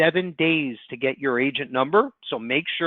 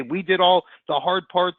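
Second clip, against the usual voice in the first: usual speaking voice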